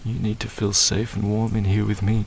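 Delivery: ordinary delivery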